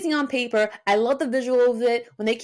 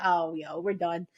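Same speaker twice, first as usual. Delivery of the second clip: retold defeat; 6 semitones lower, 4 dB quieter than usual. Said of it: "I was like".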